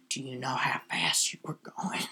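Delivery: low breathy cop whisper. Retold or imitated. Imitating cop at traffic stop.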